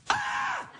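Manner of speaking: a very high, far-off yelp of fright; sudden scream. Retold or imitated. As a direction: shriek like you've just seen something awful. Buddy screams at the cornflakes.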